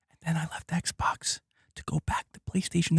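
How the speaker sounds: breathy whispered aside, voice dropped. Real, not acted. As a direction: let it slip out as a hushed confession.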